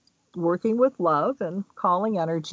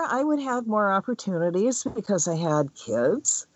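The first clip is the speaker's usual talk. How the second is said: usual speaking voice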